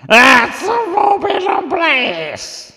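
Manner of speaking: yowling, very loud high cat voice; screaming cat. Acted. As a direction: stretch the words into feline wails. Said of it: "Try and get some more cat into it".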